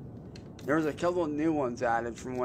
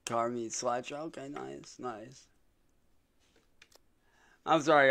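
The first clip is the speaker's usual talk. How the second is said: ordinary delivery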